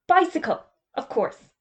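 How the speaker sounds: bicycle jumps high and loud, of course falls off; hasty correction. Acted. Mister Magica correcting his slip to the headmaster, hastily. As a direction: cover the slip quickly.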